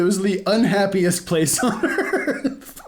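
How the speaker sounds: amused, laughter spilling in; laughing. Real, not acted. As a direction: giggle out the joke about the park. Joking about sad theme park.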